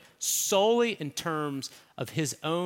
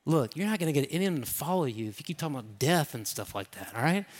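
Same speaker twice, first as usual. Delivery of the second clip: lightly voiced Peter, softer, pulling Jesus aside; hushed Peter aside; 4 semitones lower, 6 dB quieter than usual. Peter pulls Jesus aside, hushed and coaxing. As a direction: talk him down quietly, a touch conspiratorial. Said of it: "Kind of pulls him aside".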